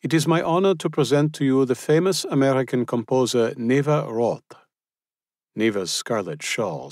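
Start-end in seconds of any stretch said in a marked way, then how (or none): 0.0-4.6 lightly voiced German host, raised; proud host. A narrator's reading. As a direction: present her with ceremony, slowing at the name. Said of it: "Otto said to the group".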